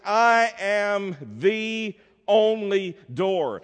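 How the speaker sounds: each word of "I am the only door" drawn out slowly; slow, weighty stress on every word of "I am the only door"; very emphatic. Retold or imitated. Preacher telling the congregation what Jesus told the disciples, very emphatic. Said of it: "very emphatically".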